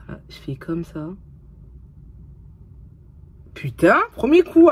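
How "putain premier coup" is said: high, loud burst of triumph; thrilled exclamation. Real, not acted. Vlogger getting a lens at the first try, thrilled. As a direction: exclaim it loudly, amazed at your luck.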